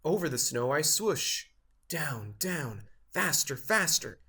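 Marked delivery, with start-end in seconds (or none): none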